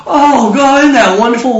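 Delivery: high, gushing exclamation; acted relief. Job hearing he is cleared, hammed-up joyful relief. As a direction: gush with mock relief. Voices Job's relief at vindication.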